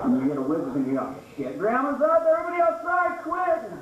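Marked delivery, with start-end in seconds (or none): 1.4-3.7 panicked yell. Retold or imitated. Kid warning the family at the cabin, high panicked shout. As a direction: sound the alarm to the whole house. Family fleeing grandma's fart.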